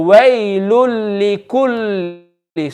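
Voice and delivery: slow, drawn-out WAYLUL LIKULLI with no nasal hum; without gunna. Shown as a demonstration. Teacher models skipping the noon for the class, without gunna. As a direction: recite waylul likulli slowly, held on the doubled L. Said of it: "we're going to read without gunna".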